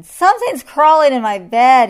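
high, very loud alarm; yelled. A narrator's reading. Lion finds ants in bed, yelled. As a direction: yell it out, horrified by the bedding. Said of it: "he yelled".

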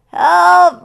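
high, very loud cry for help; roared. A narrator's reading. Lion grabbed from above, roared. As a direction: yell for rescue, panicked. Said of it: "roared the lion".

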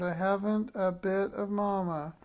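put-on high, wailing child's proclamation; acted high-pitched complaint; proclaiming. A narrator's reading. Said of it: "she proclaimed".

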